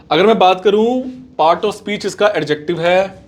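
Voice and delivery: usual speaking voice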